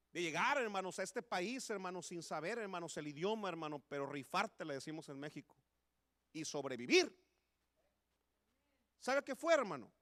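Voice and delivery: rising to a loud, impassioned preaching peak; preaching at full force. Acted. Preacher reminding immigrant brothers of their journey, building to a loud peak. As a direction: build the congregation up until you're all but shouting.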